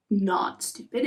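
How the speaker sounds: ordinary delivery, nothing marked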